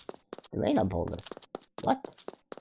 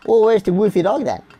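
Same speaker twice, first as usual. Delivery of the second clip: ordinary talk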